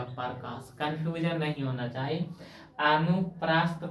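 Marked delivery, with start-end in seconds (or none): 0.0-0.7 Prakash said low and soft; soft, effortless demo of Prakash; easy, relaxed. Shown as a demonstration. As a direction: let Prakash drop out gently, without effort. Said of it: "प्रकाश भी आराम से बोलाएगा".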